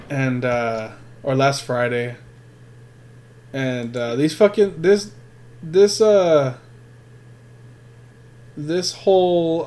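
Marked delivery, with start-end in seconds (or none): none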